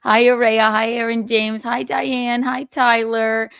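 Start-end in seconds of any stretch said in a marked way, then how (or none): none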